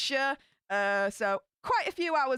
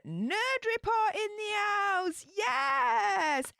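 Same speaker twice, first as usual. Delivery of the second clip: excited welcome; 8 semitones higher, much slower than usual. Real, not acted. Hyping a viewer's arrival.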